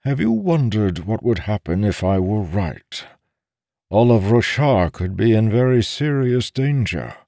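solemn, pressing character voice; grave. A narrator's reading. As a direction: warn her in all seriousness. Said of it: "Taln said gravely".

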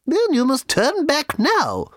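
put-on high, slow and stern old woman; severe little lady. A narrator's reading. Booth lady turning the couple back, severe and high. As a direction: lay down the law, prim and very high. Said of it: "said the little lady severely".

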